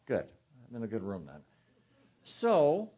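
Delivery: normal talking voice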